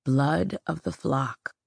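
slow, ragged, breathless voice. Acted. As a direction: speak raggedly, catching your breath. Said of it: "his voice was ragged".

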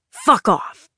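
sharp angry snarl. A narrator's reading. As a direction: spit it right at him. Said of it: "And Mom snarled".

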